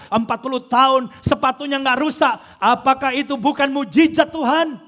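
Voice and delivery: loud, high, insistent preaching; full-force preaching. Real, not acted. Preacher to congregation, loud rising climax. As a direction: hammer the miracle home.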